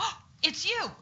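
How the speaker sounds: high, bright gasp of surprise at a welcome visitor; "Oh, it's you!" in high happy surprise; surprised greeting. Shown as a demonstration. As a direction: sound delighted to see an old friend. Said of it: "pretend like you have a child ... that just came in".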